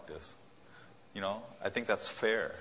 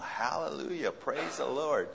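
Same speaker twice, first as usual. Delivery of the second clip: mock joy; 6 semitones higher, slower than usual. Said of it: "Showing joy?".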